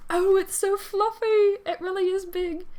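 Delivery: high, drawn-out gushing over a pet; gasping delight. A narrator's reading. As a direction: squeal it slowly, thrilled by the fluffy cat. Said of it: "and then gasps when Jeremy pulls up".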